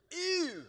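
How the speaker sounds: high, loud squeal of disgust; emphatic "ew". Shown as a demonstration. Pastor showing the congregation how to react to spiders, high loud ew. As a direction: really sell the disgusted "ew". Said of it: "gotta really say it good".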